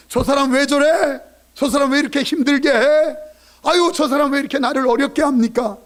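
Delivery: complaining whine. Retold or imitated. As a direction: grumble about a difficult person. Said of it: "하소연하면".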